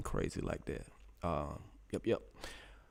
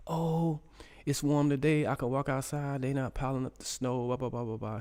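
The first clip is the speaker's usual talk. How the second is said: high, loud mock-chatty voice; imitated daughter; 7 semitones higher, 4 dB louder than usual. Dad imitating his daughter about the weather, high voice. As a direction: pitch it up and run on like an excited daughter. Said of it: "my daughter, she's probably saying stuff like".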